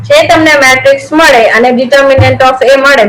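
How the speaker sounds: normal talking voice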